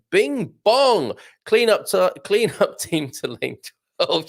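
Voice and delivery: high sing-song chime and announcement; mock tannoy announcer. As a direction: imitate a shop public-address call.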